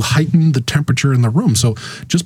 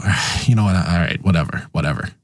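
giving up; 4 semitones lower, 3 dB quieter than usual. Retold or imitated. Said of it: "gonna eventually go".

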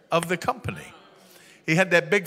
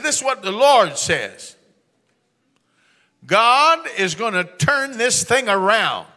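slow, loud, raised pronouncement; booming prophecy; 5 semitones higher, 7 dB louder, slower than usual. Retold or imitated. Preacher prophesying to Earl in the meeting, booming and slow. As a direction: declare it with full preacher force.

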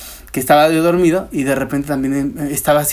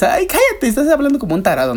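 high-pitched, brisk brush-off; acted scoffing; mock exasperation; 10 semitones higher than usual. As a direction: wave them away in a quick high voice. Acted daytime dismissal of sleep talker.

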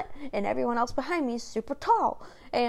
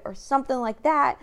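ordinary talk

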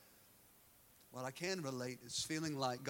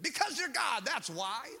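high, loud outburst; imitating a man at his wit's end; exasperated retort; 9 semitones higher, 7 dB louder than usual. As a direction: burst out as if it's obvious. Dad snaps back at God, retold.

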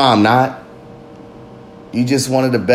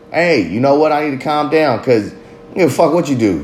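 usual speaking voice

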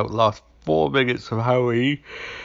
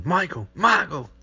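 mock shout; 9 semitones higher than usual. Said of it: "he's like".

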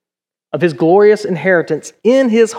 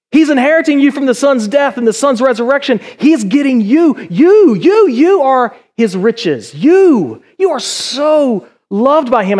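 exultant preaching; 6 semitones higher than usual. Real, not acted. Preacher tells congregation they are God's riches, exultant. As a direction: preach it with rising joy.

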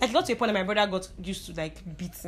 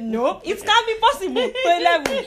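shocked disbelief; 10 semitones higher, 5 dB louder than usual. Retold or imitated. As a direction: stretch it out as if you can't believe the time. Shocked at the late time.

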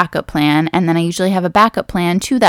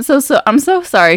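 ordinary talk, nothing marked

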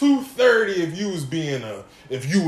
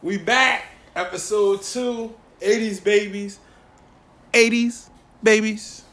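hyped intro; 5 semitones higher, 5 dB louder, slower than usual. Real, not acted.

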